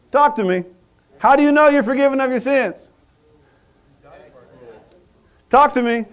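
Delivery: high, loud, urgent questioning; pressing preacher. Acted. Preacher challenging congregation, loud urgent preaching. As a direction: push them for an answer.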